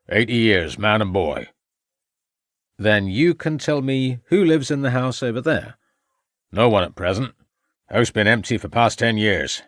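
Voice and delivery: lightly voiced old country farmer; a hint of a blunt rural old man; faint old farmer's voice, matter-of-fact. A narrator's reading. Old farmer answers the inspector.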